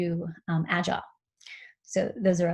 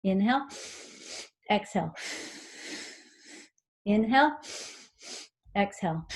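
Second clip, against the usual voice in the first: paced breathing cues; 2 semitones higher than usual. Shown as a demonstration. Coach guiding listeners through a breathing pattern, rhythmic cueing. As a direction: lead the breath count as if running alongside. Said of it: "So I'm gonna demonstrate".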